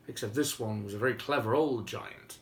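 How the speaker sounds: plain reading voice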